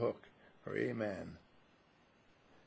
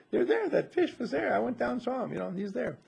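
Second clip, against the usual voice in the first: mock insistence; 9 semitones higher than usual. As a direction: insist like a stubborn student, half laughing. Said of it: "But you say".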